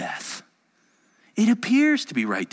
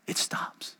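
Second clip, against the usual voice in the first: slow, near-silent whisper; stunned, breath-only hush; hushed awe; 10 dB quieter than usual. Real, not acted. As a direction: drop to a whisper as the storm ceases. Hush as the storm ceases.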